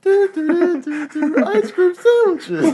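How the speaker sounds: high, slow, sung ad jingle; commercial jingle. Pretend. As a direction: sing it like a cheesy ad. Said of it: "I was doing a commercial".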